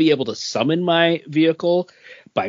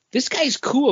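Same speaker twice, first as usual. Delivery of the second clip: lightly voiced little boy, pitched up; kid's voice; 11 semitones higher than usual. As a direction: imitate your son's excited kid voice. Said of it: "that's when my son's like".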